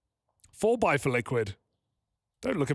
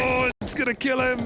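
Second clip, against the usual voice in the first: excited shout; 8 semitones higher than usual. Real, not acted. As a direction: shout it at the screen. Co-caster shouting at the play.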